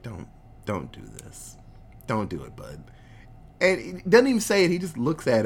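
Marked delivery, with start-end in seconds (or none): 0.0-2.8 very low, soft fatherly plea; imitating a calming dad, barely voiced; quiet dad wisdom. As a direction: talk him down gently, low and hushed. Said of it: "with that dad wisdom".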